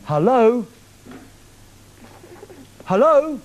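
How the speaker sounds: normal talking voice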